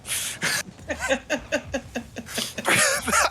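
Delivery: high helpless giggle; cracking up mid-broadcast; laughing fit. Real, not acted. Breaks up mid-bit.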